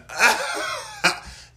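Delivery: big laugh, high and loud. Real, not acted. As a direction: burst into laughter. Laughs after joke.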